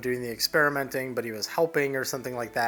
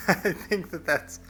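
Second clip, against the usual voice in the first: real laugh through the words, higher; 6 semitones higher, slower than usual. Real, not acted. Artist tickled by his own plant-man idea, laughing.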